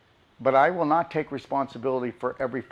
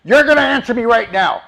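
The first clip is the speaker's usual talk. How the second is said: loud, high, insistent shout; angry yelling demand; 8 semitones higher, 11 dB louder than usual. Shown as a demonstration. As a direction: hammer each word like a furious caller. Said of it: "That's how people come at you".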